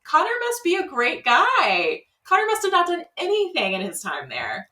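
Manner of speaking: high-pitched, marveling exclamation; mock amazement. Viewer reacting to the guys' farewell, mock amazement. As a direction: marvel at it high, dropping at the end.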